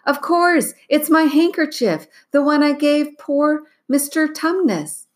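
put-on high, eager young girl voice; Lucy bursting with recognition; excited little girl. Lucy character voice.

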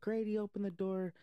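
high-pitched mock calling through a door; imitated worried parent. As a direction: call out like an alarmed parent.

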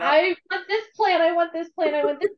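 high, whiny repeated pleading; mock-childish insisting on getting your way; insistent demand. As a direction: beg like a stubborn kid. Voices person pleading with God.

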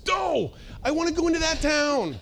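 put-on high, pleading protest like a stubborn kid; Paul pleading with God, high and whiny. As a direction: whine it up high, insisting on the town. Paul whining back at God.

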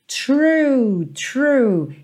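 "true" with a curled R and pursed lips; curled R, rounded lips. Shown as a demonstration. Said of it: "R também enroladinho e depois tem um biquinho".

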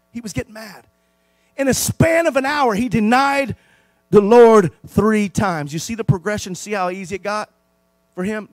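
ordinary delivery

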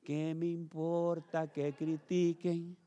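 very slow and deliberate; Hannah's devoted vow. Acted. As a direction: sing it out like a heartfelt worship vow. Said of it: "imagínese que si Hannah se pusiera".

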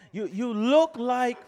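retry a put-on Southern accent, lifted pitch; hammed-up Southern accent, rising. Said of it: "That's my best Southern accent".

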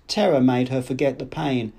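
plain reading voice

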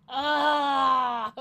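sharp loud yell of frustration; frustrated shout. Retold or imitated. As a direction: cry out in exasperation. Frustrated yell at ringing phone.

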